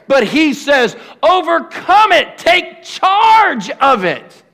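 high, loud shouted preaching, slowing on key lines; preaching at full force. Acted. Pastor calling church leaders to rise up, shouted preaching. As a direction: whip the room up to rise.

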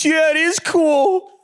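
put-on a small boy slowly agreeing with dad; little son's voice. Son answers his dad.

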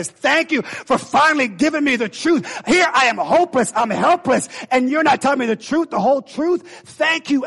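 hopeless people thanking the preacher, loud and grateful; grateful outcry. Acted. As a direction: cry out in relief. Ninevites voiced thanking preacher.

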